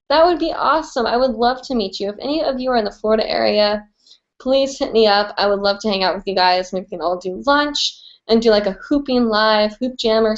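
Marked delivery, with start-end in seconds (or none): none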